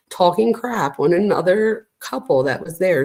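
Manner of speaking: ordinary talk, nothing marked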